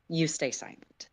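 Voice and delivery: ordinary delivery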